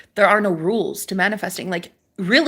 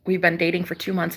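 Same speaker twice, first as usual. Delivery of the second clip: normal talking voice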